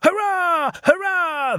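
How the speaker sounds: very high, gleeful hurrah; roaring cheer. A narrator's reading. Runaway trucks break free, roaring in triumph. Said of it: "they roared".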